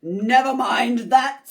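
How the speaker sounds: loud curt snap; snapped. Acted. Miss Swamp silencing the kids with a loud snap. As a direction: cut them off sharply. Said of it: "snapped Miss Swamp".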